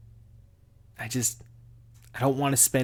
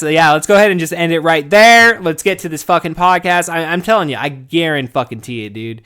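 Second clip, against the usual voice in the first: usual speaking voice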